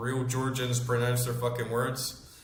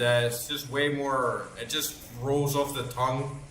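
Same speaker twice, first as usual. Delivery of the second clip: ordinary delivery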